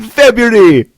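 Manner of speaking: high, loud Jamaican-accent exclamation; gleeful catchphrase in a big mock-Jamaican accent. Shown as a demonstration. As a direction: belt out FEBRUARY in a Jamaican accent. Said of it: "in a Jamaican accent".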